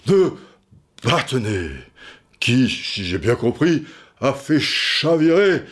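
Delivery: put-on solemn old general holding forth, a touch high; hint of the general's grand manner; de Gaulle impression. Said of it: "vous m'avez toujours fait marrer dans, dans vos trucs de voix".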